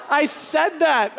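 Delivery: ordinary delivery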